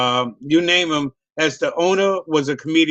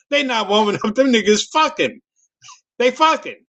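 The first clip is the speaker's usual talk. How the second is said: retold heckler's call, rising higher toward the end; mock yell from the crowd, climbing in pitch; 6 semitones higher than usual. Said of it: "Somebody yelled out".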